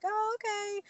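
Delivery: high, light "oh, okay"; feigned nonchalance. Retold or imitated. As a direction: cover disappointment with a high, soft okay. Hiding news from mom.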